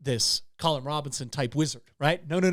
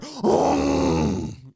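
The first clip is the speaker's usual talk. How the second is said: mock gasp; 4 semitones lower, 4 dB louder than usual. Grizzly surprises the wizard.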